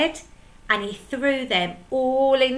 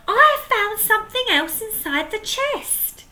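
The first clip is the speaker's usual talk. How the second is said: much higher, eager announcement; excited; 13 semitones higher, 4 dB louder than usual. Acted. Peg telling the pirates her discovery, excited. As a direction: rush it out breathless with news. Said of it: "she cried".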